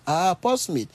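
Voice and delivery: normal talking voice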